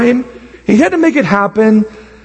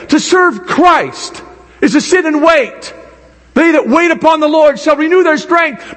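preaching at full force; 7 semitones higher than usual. Acted.

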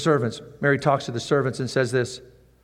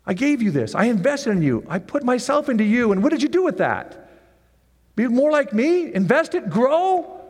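scolding master; 9 semitones higher than usual. Acted. Master scolding the lazy servant.

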